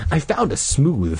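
usual speaking voice

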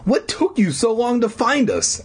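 put-on high, pushy boy voice; demanding kid. A narrator's reading. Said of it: "Elliot demanded".

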